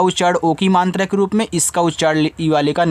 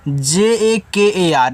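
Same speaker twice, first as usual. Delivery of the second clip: letters spelled out one by one, slowly; slow letter-by-letter spelling; slower than usual. Shown as a demonstration. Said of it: "इसको पढ़ते हैं जाकर".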